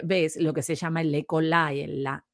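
ordinary talk, nothing marked

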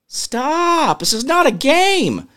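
high exasperated yell at the screen; exasperated outburst. Real, not acted. Host losing patience with a captcha, high exasperated yell. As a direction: snap at the captcha, fed up.

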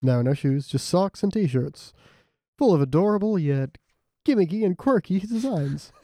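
playful announcer patter with stretched product words; mock ad read. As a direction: pitch it like a podcast advert.

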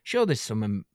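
normal talking voice

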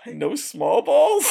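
high, drawn-out disbelief; incredulous question. Real, not acted. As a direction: slowly repeat it, pitch shooting up, baffled. Baffled reaction to odd line.

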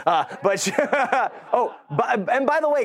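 breaking up laughing, pitch jumping high; real laughter. Real, not acted. Husband cracking up mid-story about his wife, laughing. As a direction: laugh through the false start.

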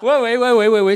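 high, loud, rapid agreement; eager yeses. Real, not acted. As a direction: gush out the yeses.

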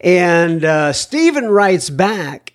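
ordinary talk

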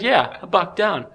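smug and giggling; mock cocky, laughing. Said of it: "trying to be, like, real cocky".

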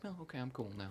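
very soft, offhand mock composure; suddenly calm. Imitating a movie character abruptly calming down after spazzing out, suddenly calm. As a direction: drop to a quiet, settled murmur. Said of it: "and then a scene will change, and she's like".

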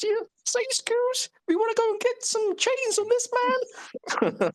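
squeaky high voice. Pretend. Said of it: "us doing impressions".

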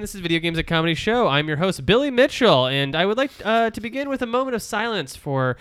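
loud, fast TV host announcing; announcer voice. Acted. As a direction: boom it like a live show host. Fake game show opening.

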